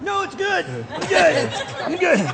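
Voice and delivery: squeaky high voice; high-pitched. Pretend. Repeated reassurance in high voice.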